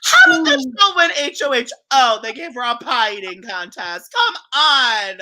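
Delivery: loud, high-pitched disbelief; exasperated and loud, pitch leaping up; incredulous outburst. Real, not acted. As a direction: protest it like an outrageous injustice. Mock outrage at the contest.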